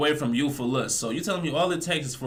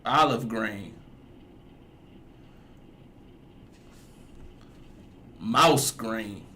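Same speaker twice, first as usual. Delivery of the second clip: slow teasing list; 4 dB quieter, much slower than usual. Real, not acted. Teasing the speaker in the clip.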